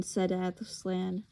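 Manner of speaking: ordinary delivery, nothing marked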